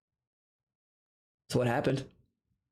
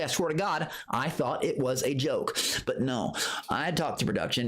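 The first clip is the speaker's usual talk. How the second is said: normal talking voice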